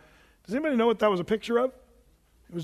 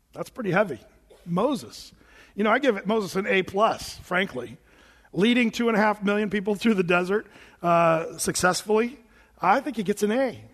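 ordinary delivery

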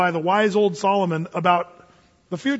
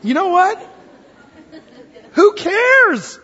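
excited exclaiming; 12 semitones higher, 7 dB louder, slower than usual. Real, not acted.

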